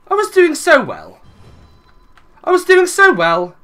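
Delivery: high, loud wail of frustration; loud, high-pitched lament; anguished protest. Real, not acted. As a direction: cry out at the unfairness. Laments his wrecked camp.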